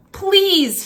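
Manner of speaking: high, loud begging cry; cried plea. Acted. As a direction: beg him desperately. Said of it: "he cried".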